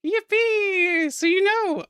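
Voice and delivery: high, excited squeal of delight; delighted cheer. Real, not acted. Streamer greets a Norwegian viewer's news, delighted cheer.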